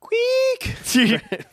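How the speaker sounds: high squeaky Squeak. Acted. Reading Frank's whisper line.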